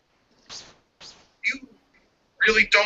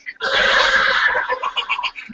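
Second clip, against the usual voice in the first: mocking laugh; 11 semitones higher than usual.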